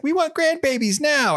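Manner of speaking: high-pitched, slow mock demand; demanding parents. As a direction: imitate pushy would-be grandparents.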